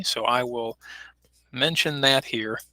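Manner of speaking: ordinary delivery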